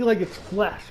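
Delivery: ordinary talk, nothing marked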